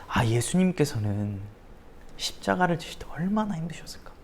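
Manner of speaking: voiceless whisper of pity; awed whisper. Acted. Preacher imagining Christ's pain, whispering in pity. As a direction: breathe it like a secret sorrow.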